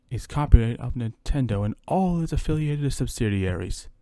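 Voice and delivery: usual speaking voice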